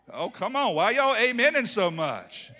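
rally the congregation; playful preacher exclamation; excited outburst. Real, not acted.

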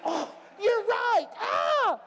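high, loud, delighted cry; excited shout. Retold or imitated. Atheist friend realizing he's caught, excited shout. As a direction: burst out as the thrilled atheist. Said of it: "he got excited about it".

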